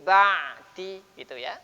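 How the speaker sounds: ba'di slow, nun turned to mim; iqlab recitation. Shown as a demonstration. Teacher showing iqlab in mim ba'di. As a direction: glide mim into ba'di slowly. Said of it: "ini bacaan iklab".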